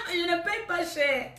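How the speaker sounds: slow, breathless laughter through the words; can barely get it out for laughing. Real, not acted. Laughing at a viewer's question about her rent. Asked how much rent she pays.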